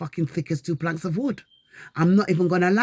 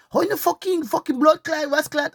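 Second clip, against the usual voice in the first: high, heated Jamaican-style cussing; angry cursing; 10 semitones higher than usual. Retold or imitated. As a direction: spit out the curses in a rage.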